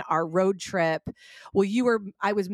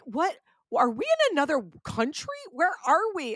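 mock bewildered; 6 semitones higher than usual. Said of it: "Both of us were like".

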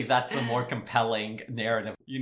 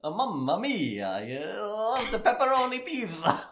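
put-on Italian accent; 11 semitones higher, 4 dB louder than usual. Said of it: "through the character Tony Fauci".

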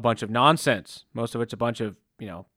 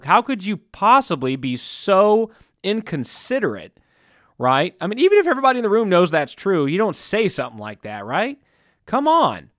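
higher and louder, fired-up indignation; indignant outburst; 5 semitones higher, 4 dB louder than usual. Real, not acted. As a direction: let the outrage build, fast and high. Indignant rant about the inconsiderate.

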